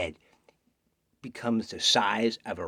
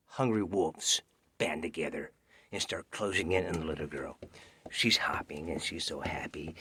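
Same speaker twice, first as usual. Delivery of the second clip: ordinary delivery, nothing marked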